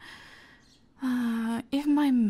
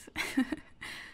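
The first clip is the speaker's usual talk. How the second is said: soft, breathy little laugh; 4 dB quieter than usual. Acted. Laughing softly at the sleeping beauty joke. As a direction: laugh under your breath.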